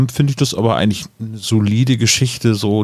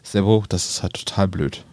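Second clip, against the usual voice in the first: lightly voiced author, soft and low; a hint of a quiet grumpy author; Ben Nevis; 3 semitones lower, 6 dB quieter than usual. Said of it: "vielleicht sagt Ben Nevis dann".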